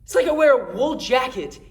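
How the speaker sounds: tense confession of rage, halting then forceful; agitated, angry Jason. Acted. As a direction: let the frustration break out in emphatic bursts. Jason's monologue turns to fury.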